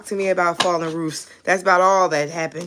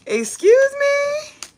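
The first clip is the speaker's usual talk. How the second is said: drawn-out, much higher squeak of disbelief; shocked excuse me; 5 dB louder, much slower than usual. Real, not acted. Hearing her viewer's roof fell in, she squeaks in disbelief. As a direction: act stunned at the news, slowly.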